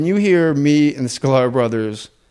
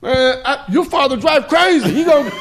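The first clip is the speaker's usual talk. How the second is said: much higher, louder panicked dog voice, laughing; dog character; 11 semitones higher, 5 dB louder than usual. Pretend. Dad voicing the dog to cheer his sobbing son, high frantic shout. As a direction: squeal in mock panic at the crazy driving. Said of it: "he was, like, sobbing, and I'm like".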